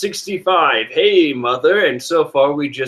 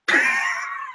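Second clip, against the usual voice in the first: shrill scream; 5 dB quieter than usual. Retold or imitated. Big Mom scream after "scream yet.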